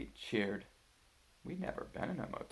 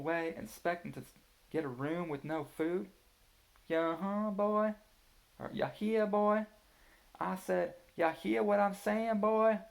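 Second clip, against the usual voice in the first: put-on hillbilly accent; 9 semitones higher, 6 dB louder than usual. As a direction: play a mock Southern hillbilly teasing the kids. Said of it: "Daddy did an imitation of a hillbilly accent".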